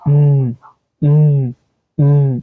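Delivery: উম as a sustained hummed "mmm"; held M sound. Shown as a demonstration. Teacher answering what M sounds like, উম hummed "mmm". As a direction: hum উম on closed lips, each separately. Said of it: "এম এর sound কি হবে?".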